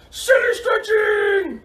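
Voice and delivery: very high, drawn-out silly shout; silliest voice. Shown as a demonstration. Coach asking kids for silliest voice, high silly cheer. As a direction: squeal it slowly, as silly as possible. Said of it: "in your silliest voice".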